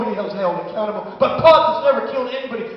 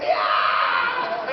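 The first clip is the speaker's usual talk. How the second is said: high rock-star scream of yeah; 12 semitones higher than usual. Retold or imitated. Comic mimicking the car ad's rock singer, screaming.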